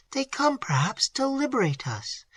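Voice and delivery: high, soft, hesitant hope; hopeful. A narrator's reading. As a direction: offer a fragile comfort. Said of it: "said Emily".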